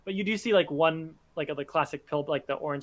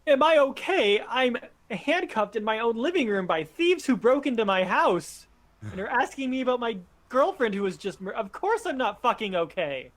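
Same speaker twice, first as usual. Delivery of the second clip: furious outburst; 6 semitones higher than usual. Acted. Handcuffed man snapping at his captors, furious outburst. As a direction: explode at the question. Angry captive snaps back.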